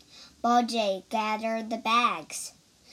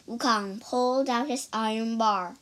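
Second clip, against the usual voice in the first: plain reading voice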